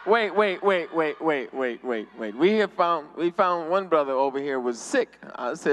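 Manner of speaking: usual speaking voice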